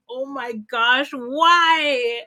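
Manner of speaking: drawn-out, rising "why"; mock despair, dragging out the words; a loud, stretched-out lament; exasperated wail. Acted exasperated plea in story.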